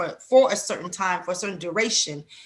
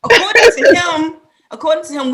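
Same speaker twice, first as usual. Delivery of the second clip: emphatic; 7 semitones higher, 11 dB louder than usual. Real, not acted. Emphatic repetition.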